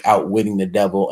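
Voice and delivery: normal talking voice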